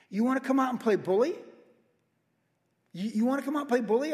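high, loud schoolyard taunt; calling out a bully; mock taunting challenge. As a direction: dare them to come out and fight.

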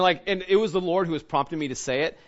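ordinary talk

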